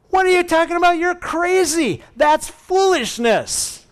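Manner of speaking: high, loud mock disbelief; incredulous outburst. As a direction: protest wildly that this makes no sense. Servant scoffing at Elisha's answer.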